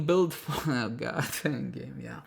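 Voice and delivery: breaking into real laughter mid-phrase; can't keep straight face. Real, not acted. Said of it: "I just can't say that with a straight face".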